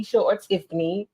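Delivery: ordinary talk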